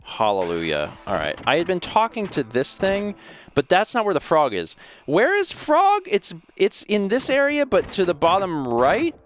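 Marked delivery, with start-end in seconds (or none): 1.5-9.1 high character voice. Acted. Reads game character dialogue.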